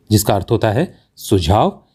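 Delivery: ordinary talk, nothing marked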